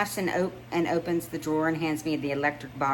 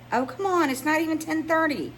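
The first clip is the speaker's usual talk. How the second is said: much higher, wheedling protest; playful coaxing; 12 semitones higher than usual. Acted. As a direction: beg her to stay up. Coaxing friend into more wine.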